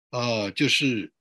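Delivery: usual speaking voice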